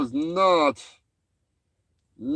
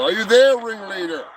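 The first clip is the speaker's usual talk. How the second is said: calling out; 5 semitones higher, 4 dB louder than usual. Acted. Calling over the radio.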